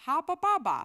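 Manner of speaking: high, loud nonsense dog chant; Dog of Wisdom. Retold or imitated. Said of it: "that is the Dog of Wisdom video".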